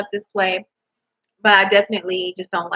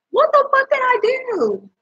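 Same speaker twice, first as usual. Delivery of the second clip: incredulous high squeal. Real, not acted. To viewers about racism claims, incredulous high-pitched squeal. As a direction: pitch shoots way up, slow and disbelieving.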